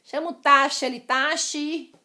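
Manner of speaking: mock calling a cab. Shown as a demonstration. Teacher acting out hailing a cab wrongly, loud. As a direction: shout taxe as if hailing a cab. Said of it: "eu não falo taxe".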